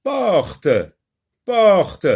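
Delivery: "porte" high and drawn out, final t released; teacher's careful model of "porte"; sounded-out word. Shown as a demonstration. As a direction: say "porte" twice, clearly releasing the "te". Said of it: "Essayez d'écouter".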